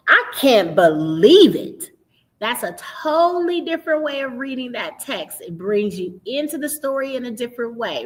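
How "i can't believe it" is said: "I can't believe it" said slow and loud with sweeping pitch; expressive exclaim. Shown as a demonstration. Teacher showing expressive reading, loud and drawn out. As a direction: lift the words off the page with feeling, slow. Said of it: "but what if I say".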